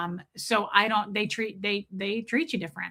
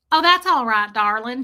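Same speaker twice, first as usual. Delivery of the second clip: loud, pitched-up Southern waitress impression; Southern sass; 7 dB louder than usual. Server brushing off a customer's apology, sassy Southern accent. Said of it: "This is that Southern sass right here".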